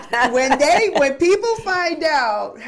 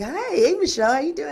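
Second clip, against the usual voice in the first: a hint of a sultry greeting; lightly voiced raspy hello; sexy voice imitation; 5 semitones higher than usual. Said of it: "you got a sexy voice".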